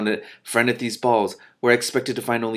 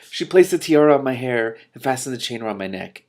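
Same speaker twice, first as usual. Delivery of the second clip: ordinary delivery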